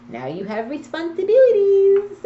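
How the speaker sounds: a sudden high, loud scold; a high, loud warning to a careless new father. Real, not acted. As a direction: raise your voice to drive the point home.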